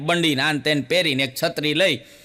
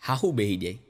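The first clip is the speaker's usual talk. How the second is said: low, soft sitting down; 7 semitones lower, 5 dB quieter, faster than usual. Acted. Mother-in-law sits down.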